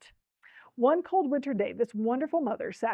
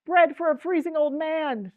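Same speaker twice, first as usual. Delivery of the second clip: raised, louder plea from the street; beggar's cry; 6 semitones higher, 6 dB louder than usual. A narrator's reading. Freezing beggar calling for food from the street below, crying out. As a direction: call out high like a begging old man. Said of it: "the cry of a poor beggar".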